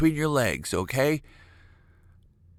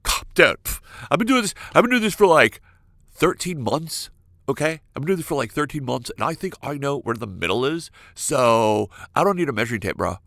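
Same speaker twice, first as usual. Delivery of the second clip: indignant dude. Acted. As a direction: play a defensive guy brushing off advice. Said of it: "men's reactions when I tell them that they should measure".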